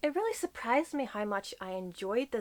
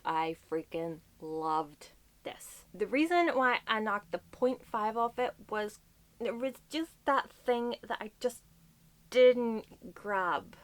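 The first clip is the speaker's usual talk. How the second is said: usual speaking voice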